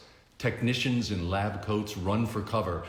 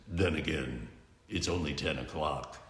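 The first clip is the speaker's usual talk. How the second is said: low, soft, dry hero voice; deadpan gravel tease; Batman's low taunt; 5 semitones lower, 7 dB quieter than usual. Acted. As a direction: hero mocking a villain quietly.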